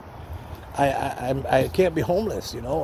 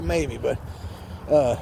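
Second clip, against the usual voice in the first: ordinary talk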